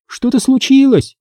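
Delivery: put-on high-pitched girl's cry; called out. A narrator's reading. Girl running up the path, high call. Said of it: "крикнула она".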